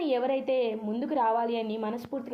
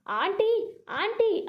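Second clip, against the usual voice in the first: high, loud call across the house; loud shout; 8 semitones higher, much slower than usual. Acted. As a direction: shout for the aunt to come. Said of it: "గట్టిగా అరుస్తుంది". (గట్టిగా అరుస్తూ పిలవడం)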